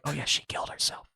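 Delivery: quick, hushed throwaway delivery; casual, near-whispered mimicry; offhand aside. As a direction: rush it out under the voice. Repeats friends' casual news, muted.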